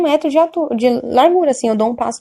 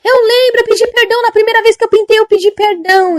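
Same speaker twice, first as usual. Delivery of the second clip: put-on high, louder pleading old woman's voice; anxious old lady; 11 semitones higher, 4 dB louder than usual. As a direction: plead like a frightened elderly woman confessing. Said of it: "Ela falou assim".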